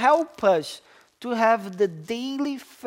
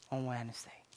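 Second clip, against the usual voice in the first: whisper; 10 semitones lower, 18 dB quieter, faster than usual. Real, not acted. Sermon ends on a whisper.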